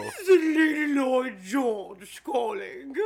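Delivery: slow, loud hammed-up British lady; posh British accent. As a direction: shout it like a grand British caller. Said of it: "crazy British guy voice".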